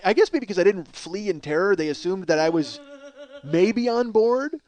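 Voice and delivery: ordinary talk, nothing marked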